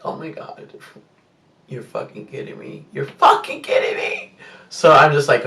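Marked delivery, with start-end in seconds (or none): 0.0-4.3 unvoiced whisper rising to a squeak at the end; whispered disbelief. Hearing them kiss, she whispers her disbelief. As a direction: mutter it in a stunned whisper.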